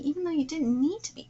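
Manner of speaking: ordinary talk, nothing marked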